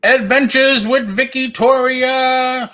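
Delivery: high, slow, announcer-style show title; teasing show title. Pretend. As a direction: sing out the nickname to tease her. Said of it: "She hates Vicky Toria. I do it on purpose".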